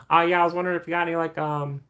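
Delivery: high-pitched hesitant customer voice; mock customer, stalling on the ums; drive-thru customer. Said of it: "Pull up to McDonald's".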